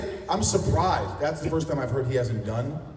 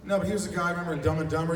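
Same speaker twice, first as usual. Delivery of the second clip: ordinary talk, nothing marked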